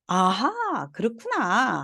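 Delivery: drawn-out aha that bends and rises; dawning realization. Acted. Teacher acting out someone who just understood, dawning aha. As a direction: act out suddenly getting it. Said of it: "우리가 이렇게 깨달음이 오거나".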